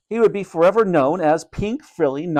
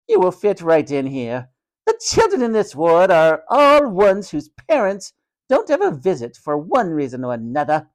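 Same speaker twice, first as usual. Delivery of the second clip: high haughty matron, swooping with contempt; scornful matron; 7 semitones higher, 4 dB louder than usual. A narrator's reading. Matron humiliating the children of the ward, loud and scornful. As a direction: lay out their misfortunes with relish.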